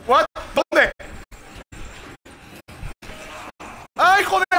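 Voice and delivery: high startled scream; scared yelp. Real, not acted. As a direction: jump out of your skin. Jump scare in game.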